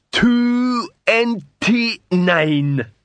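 slow, high, dim troll counting bricks. A narrator's reading. Troll answers sum.

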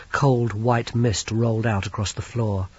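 ordinary delivery

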